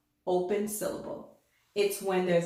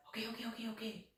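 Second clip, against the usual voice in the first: much softer, giving in; meek okays; 15 dB quieter, much faster than usual. Acted. The vowel O giving in to letter T, much softer. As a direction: mumble okay like a scolded kid. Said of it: "He says".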